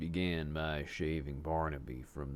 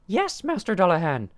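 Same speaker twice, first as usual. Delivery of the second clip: very high, loud submissive cry; 15 dB louder than usual. A narrator's reading. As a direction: cry out meekly to your master. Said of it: "Barnaby cried".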